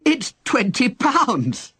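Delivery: high, slow, drawn-out yell of delight; excited yell. Acted. Young man shouting up to Henry, excited yell. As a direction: yell it up while jumping with joy. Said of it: "the man yelled, jumping up and down".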